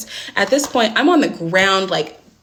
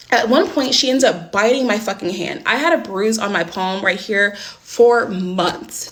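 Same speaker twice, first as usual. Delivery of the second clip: ordinary delivery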